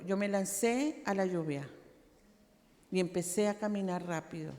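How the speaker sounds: ordinary talk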